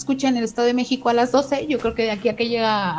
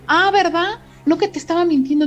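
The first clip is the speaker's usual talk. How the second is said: high, loud mock indignation; sarcastic told-you-so; 6 semitones higher, 6 dB louder than usual. Daughter mocking her doubting father, loud sarcastic told-you-so. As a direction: throw it back at him, told you so. She gloats that dad heard it.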